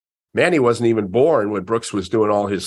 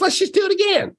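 high, breezy and cheerful. Retold or imitated. Brooks reassures the embarrassed kid, high and cheerful. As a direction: shrug it off with a bright lift. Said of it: "he was just that cheerful".

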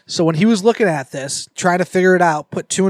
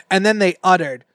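ordinary talk, nothing marked

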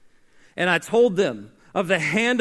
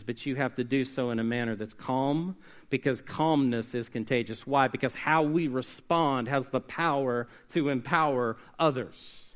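much softer and lower, steady; quiet and calm; 7 semitones lower, 7 dB quieter than usual. Acted. Preacher modelling calm for the congregation, quiet. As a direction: let the calm carry it.

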